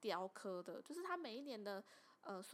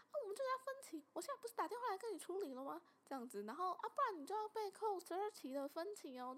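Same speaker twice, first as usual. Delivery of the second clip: much higher mock mainland Chinese accent; 8 semitones higher, faster than usual. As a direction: play the pushy scammer in a mainland Chinese accent, high and quick. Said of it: "中国腔". (高音模仿大陆腔诈骗者)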